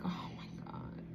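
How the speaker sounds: hushed, whispered swoon; lovestruck whisper. Acted. This person looking at the one they want, lovestruck whisper. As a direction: whisper it to yourself, overcome. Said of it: "this person just looks like".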